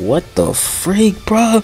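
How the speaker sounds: high-pitched, drawn-out disbelief; astonished reaction. Real, not acted. Gamer reacting to a ninjutsu's footage, astonished. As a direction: let the voice climb high in amazement.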